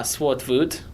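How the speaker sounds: Swartvoet said slowly, the "voet" like "foot"; pronounced Swartvoet. Shown as a demonstration. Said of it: "it's pronounced".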